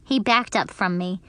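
ordinary delivery, nothing marked

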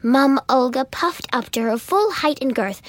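ordinary delivery

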